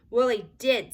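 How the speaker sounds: loud, indignant retort; indignant child. A narrator's reading. Ramona loudly defending herself to Henry. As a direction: snap it back hard.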